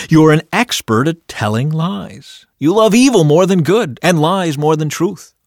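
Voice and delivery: loud, high denunciation; dramatic, indignant scripture reading; accusing. A narrator's reading. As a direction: denounce a liar with mounting force.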